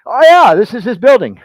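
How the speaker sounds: high, loud, hammed-up exclamation; mock loud boast. Restaurant diner name-dropping Daniel, loudly and comically exaggerated. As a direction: belt it out as a comic imitation.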